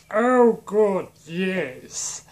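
high, loud start to a drawn-out villain gloat; hammed-up, stretched villain delight; Space Voldemort. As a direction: relish each word in a put-on villain voice. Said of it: "Space Voldemort".

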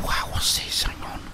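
usual speaking voice

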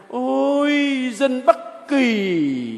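slow, drawn-out mocking "dân Bắc Kỳ"; sarcastic. Priest voicing the crowd mocking the northerners, sarcastic. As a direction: sneer at the northerners. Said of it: "cho nó có vẻ mỉa mai đi".

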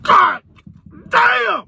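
shouted God damn; loud exclamation. Acted. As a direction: yell it out big. Outro exclamation.

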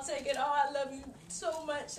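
ordinary delivery

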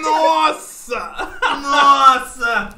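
loud laughing exclamation. Real, not acted. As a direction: burst out laughing while shouting. Reacting to a crude joke.

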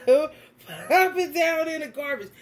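normal talking voice

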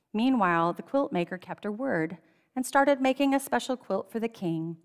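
plain reading voice

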